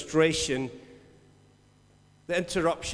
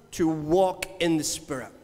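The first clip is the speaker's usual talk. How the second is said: ordinary delivery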